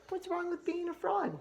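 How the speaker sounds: high, sing-song dad frog; a goofy father frog asking; squeaky cartoon dad; silly dad frog voice.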